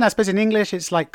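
ordinary delivery

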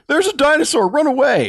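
high, loud warning yell; mock alarm shout. Retold Billy yelling into tent.

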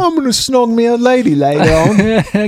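high, hammed-up British accent; mock British accent. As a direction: brag like a cheeky Brit. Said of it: "work the word snog".

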